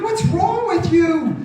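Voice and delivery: high, loud, drawn-out protest; hammed-up indignant shout; exasperated outburst. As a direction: cry out in slow, loud disbelief. Exasperated reaction to the waiter.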